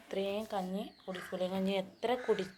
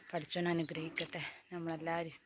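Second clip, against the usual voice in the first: ordinary delivery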